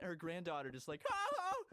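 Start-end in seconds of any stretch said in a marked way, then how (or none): 1.1-1.6 imitated granddaughter's startled shrieks; two high, short screams; panicked yell. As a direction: shriek as if jolted awake. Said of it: "then she starts yelling".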